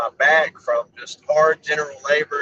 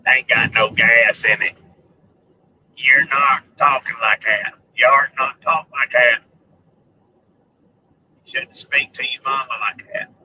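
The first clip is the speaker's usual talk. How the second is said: Sling Blade impression; 4 semitones lower than usual. Vlogger stuck quoting Sling Blade, low character voice. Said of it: "I fell off in the sling blade hole".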